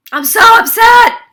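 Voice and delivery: scream. Shown as a demonstration. Showing people who scream while tapping, full scream. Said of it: "Some people scream".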